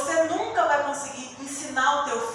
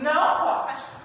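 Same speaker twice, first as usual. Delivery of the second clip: put-on louder, firm no to the child; firm teacher no; 4 semitones higher, 7 dB louder, slower than usual. Teacher telling a child in her class she must not, firm. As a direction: shut it down kindly but firmly. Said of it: "E eu disse assim".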